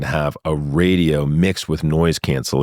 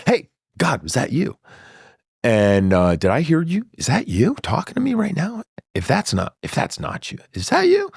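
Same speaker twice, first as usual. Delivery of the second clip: excited calling; 10 semitones higher than usual. Retold or imitated. Calling up to God, amazed.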